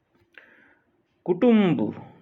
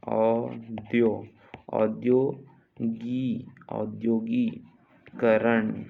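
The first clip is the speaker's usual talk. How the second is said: slow build-up of औद्योगिकरण; piece by piece; much slower than usual. Shown as a demonstration. Teacher dictating to students, औद्योगिकरण slowly in pieces. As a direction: sound out औद्योगिकरण in growing chunks.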